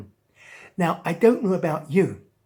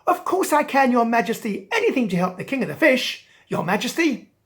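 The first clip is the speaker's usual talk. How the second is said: higher, louder, quick and keen; eager turtle; 7 semitones higher, 5 dB louder than usual. Acted. Turtle agreeing to help.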